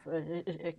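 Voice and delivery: usual speaking voice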